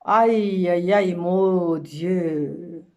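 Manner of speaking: longue plainte forte, voyelles traînées; gémir très lentement, à pleine voix; lamentation. Acted. Lamentation de la femme dédaignée. (plainte théâtrale étirée)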